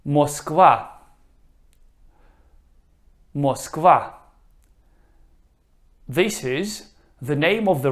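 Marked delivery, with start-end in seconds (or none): 0.0-4.1 Moskva with stress on the last syllable, Mosk-VA; Russian pronunciation. Shown as a demonstration. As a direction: clearly model Moskva for repeating. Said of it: "I will pronounce the word".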